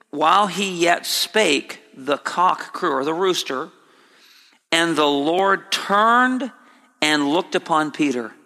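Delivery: ordinary delivery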